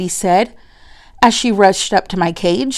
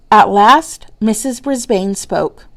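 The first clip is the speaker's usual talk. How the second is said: ordinary delivery, nothing marked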